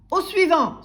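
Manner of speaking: high, loud shout; vociferating greengrocer. A narrator's reading. Greengrocer dismissing timid customer, yelling. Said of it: "vociféra le marchand de légumes".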